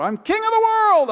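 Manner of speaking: soaring high triumphant movie cry; Leonardo DiCaprio. Retold or imitated. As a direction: shout it from the top like the ship's bow. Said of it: "stood up there like Leonardo DiCaprio".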